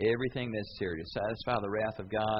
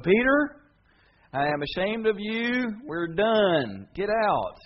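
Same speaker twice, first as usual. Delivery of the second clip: much higher, louder, slow stern; angry rebuke; 10 semitones higher, 8 dB louder, slower than usual. Pretend. Jesus as imagined rejecting Peter, angry. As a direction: cut him off for good.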